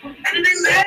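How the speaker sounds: usual speaking voice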